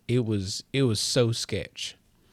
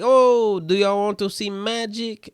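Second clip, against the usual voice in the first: high, loud showman's offer; magician impression; 10 semitones higher, 8 dB louder than usual. Magician's entrance acted in retelling.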